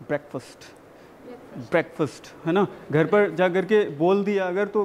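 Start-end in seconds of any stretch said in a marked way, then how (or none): 0.1-2.3 ब्रेकफास्ट as "BREK-fust", low and soft, with a pause between; correct pronunciation drill. Shown as a demonstration. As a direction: model ब्रेकफास्ट with a weak, short "fust". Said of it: "not breakfast". (ब्रेकफास्ट को धीमी आवाज़ में "ब्रेकफ़स्ट" बोलें)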